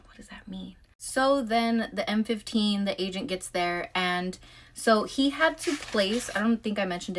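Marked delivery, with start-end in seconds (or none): 0.1-0.7 breathy puzzled whisper to himself; soft retold whisper of confusion; whispered thought. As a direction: whisper it like a private question. Hushed puzzled thought, retold scene.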